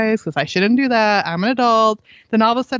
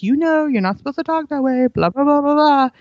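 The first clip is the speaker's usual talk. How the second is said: higher, droning nagging lecture; mock lecture; 6 semitones higher than usual. Mom parodies parents lecturing kids, mock nagging. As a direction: rattle off a scolding you know they tune out. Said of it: "So many times we try to lecture them".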